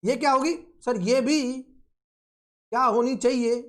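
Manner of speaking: ordinary talk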